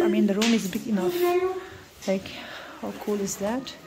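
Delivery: quiet bedtime vlog voice. Real, not acted. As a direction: talk softly in a dark room at bedtime. Kids ready to sleep in room.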